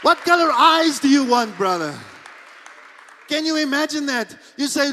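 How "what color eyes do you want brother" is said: louder and higher in wonder; excited. Real, not acted. Preacher marveling to the congregation, excited. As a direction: marvel at it aloud.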